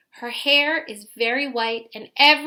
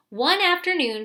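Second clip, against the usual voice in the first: usual speaking voice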